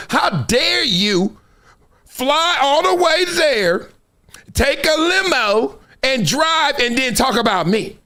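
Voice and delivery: high, slow, indignant imitation of a woman; mock outraged woman. Imitating a woman scolding over a beef. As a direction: drag out the outrage in a put-on woman's voice. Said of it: "a woman will say".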